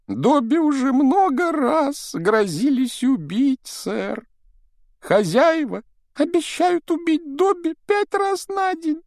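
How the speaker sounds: high, squeaky pleading elf; house-elf voice. Acted. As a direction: play Dobby the anxious house-elf. Dobby, sadly smiling. (тонкий голос домового эльфа)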